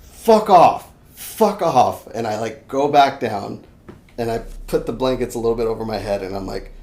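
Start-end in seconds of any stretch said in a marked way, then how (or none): 0.0-2.1 loud, raised-pitch dismissal; forceful retort, easing slightly on the repeat; defiant. Retold or imitated. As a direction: act out sending a spirit away. Acts out banishing the presence.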